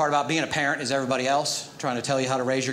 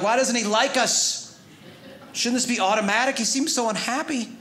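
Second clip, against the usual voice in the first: fretting new dad; 7 semitones higher than usual. Retold or imitated. Worried new father fretting.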